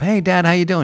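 breezy, upbeat greeting with no idea what's coming; cheery greeting. Retold or imitated. Cheerfully greeting dad at door.

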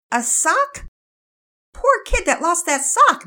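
high, loud, slow pity; amused surprise. Acted. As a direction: react to the odd news. Mother voiced in read story.

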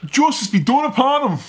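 put-on high Irish-accented battlefield cry; Aragorn, Irish accent. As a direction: proclaim it in a thick Irish accent. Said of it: "puts on an Irish accent".

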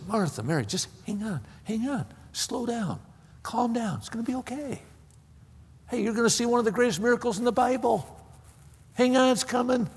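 soft, gently urging them to hold on; calm someone down in a quiet, soothing voice; hushed reassurance. Acted. Preacher imagines telling Martha and Mary to wait, hushed and reassuring.

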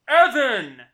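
sudden loud bellow of EVAN; name yelled in all caps; shouted name. Acted. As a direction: belt out the name Evan like a hype announcer. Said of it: "Evan, just really loudly".

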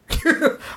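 a quick airy chuckle at his own line; breathy laugh. Real, not acted. Laughing at how he told Larry off. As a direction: let a short breathy laugh slip out.

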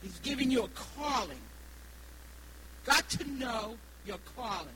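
normal talking voice

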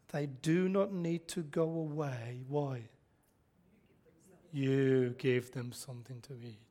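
soft, slow, firm correction; Jesus quietly corrects his disciples; quiet rebuke. Retold or imitated. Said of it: "This is a rebuke".

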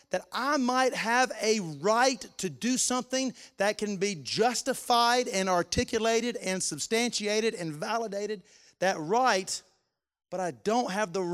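loud, high impassioned preaching; fervent preaching. Acted. Pastor urging the church to value others, full-force preaching.

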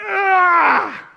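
high, loud exasperated yell; sudden frustrated "ah" yell; frustrated cry. Shown as a demonstration. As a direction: let out a fed-up shout.

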